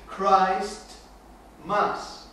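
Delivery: "Christ" then "Mass", spaced apart with pauses; word split slowly. Shown as a demonstration. Preacher breaking the word apart for listeners, slowly. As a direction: separate "Christ" and "Mass" as two words. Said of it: "se pronuncia".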